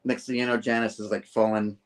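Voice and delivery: plain reading voice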